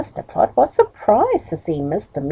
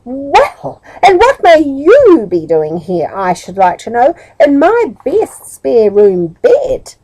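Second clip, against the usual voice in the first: higher, louder outraged scolding, slowing at the end; landlady demanding answers, higher and louder; angry, astonished woman; 5 semitones higher, 5 dB louder, slower than usual. Acted. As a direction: scold the stranger in her bed. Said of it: "in a most angry and astonished voice".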